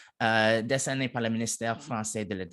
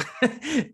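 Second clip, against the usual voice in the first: short laugh; 13 semitones higher, 3 dB louder than usual. Real, not acted. Laughs at own number slip.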